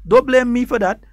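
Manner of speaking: ordinary talk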